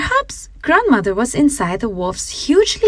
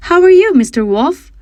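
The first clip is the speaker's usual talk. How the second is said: put-on high, louder sweet girl's voice; cooing girl; 9 semitones higher, 6 dB louder, faster than usual. A narrator's reading. Girl cooing back at the wolf, sweet and high. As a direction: coo it back innocently. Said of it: "she cooed in return".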